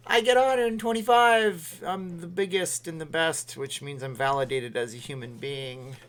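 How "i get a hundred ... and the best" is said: boastful voice. Pretend. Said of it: "and then I'll be like".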